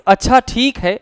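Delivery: अच्छा stretched long, high and loud, then a falling ठीक है; mock revenge, अच्छा pulled long with a fall; drawn-out vengeful achha. Shown as a demonstration. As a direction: draw out अच्छा like a veiled threat. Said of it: "और ज्यादा खींच करके कहेंगे".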